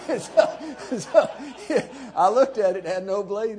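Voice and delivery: can't stop laughing at the memory; laughing hard. Real, not acted. Cracks up at the story.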